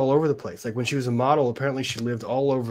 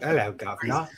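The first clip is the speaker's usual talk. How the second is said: mock Cockney greeting; 4 dB quieter than usual. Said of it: "I get money for doing accents".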